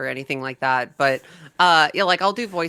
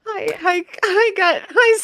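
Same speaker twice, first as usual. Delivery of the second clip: excited squeal; 7 dB louder, slower than usual. Real, not acted. Greets overwhelmed, squealing.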